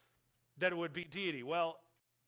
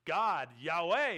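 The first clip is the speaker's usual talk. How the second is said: emphatic proclamation; 8 dB louder, slower than usual. Acted. Preaching climax on divine name.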